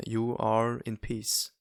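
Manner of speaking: ordinary delivery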